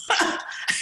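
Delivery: quiet, airless chuckle; a breathy little laugh. Real, not acted.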